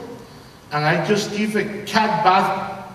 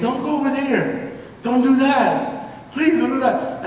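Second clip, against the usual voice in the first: mock pleading friends; 9 semitones higher, slower than usual. Friends begging him not to.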